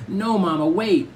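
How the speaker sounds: urgent pleading. A narrator's reading. Little sister stands between mother and brother, urgently pleading. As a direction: plead high to stop her.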